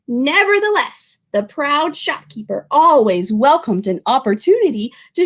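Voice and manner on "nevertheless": ordinary delivery, nothing marked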